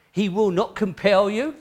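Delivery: usual speaking voice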